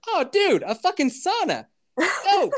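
high, loud excited discovery; thrilled to find a sauna. Retold or imitated.

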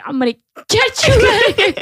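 high, hammed-up rescue promise; frantic reassurance. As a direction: mock-desperate big sister to the rescue. Acting frantic rescue of sister.

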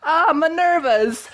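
high, hammed-up bad Italian accent; terrible Italian accent. Zeus exclaiming as Athena bursts from his head, terrible Italian accent. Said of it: "he exclaimed in a terrible Italian accent".

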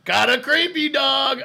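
high, slow mock heckle; mock Dave heckle. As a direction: repeat the jeer for fun. Quoting Dave's heckle again.

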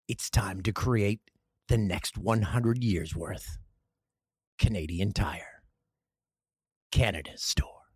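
low, slow announcer read; slow, deep ad tagline, trailing into near whisper; commercial voiceover. Shown as a demonstration. Closing the Canadian Tire ad, slow low voiceover. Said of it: "Here's your voiceover part".

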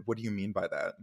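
ordinary talk

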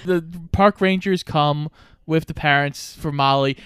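ordinary delivery

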